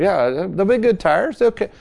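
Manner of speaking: high, slow, syrupy salesman pitch; hammed-up salesman. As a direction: oversell it like a slick tire dealer. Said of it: "tire dealer".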